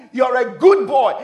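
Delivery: loud, high praise to a son; loud affirmation. Retold or imitated. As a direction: declare it firmly and loudly. Father affirming his son, first take.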